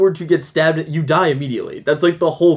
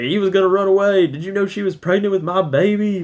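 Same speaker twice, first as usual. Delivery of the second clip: mock country cop; 7 semitones higher than usual. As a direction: ham up the cop's lament, pitched up. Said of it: "she's like".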